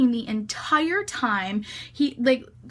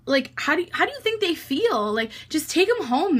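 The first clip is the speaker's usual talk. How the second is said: higher, swooping imitation of an indignant appeal; exasperated pleading; 4 semitones higher than usual. Guys beg the driver for the girls, exasperated pleading. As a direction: act out guys reasoning in disbelief.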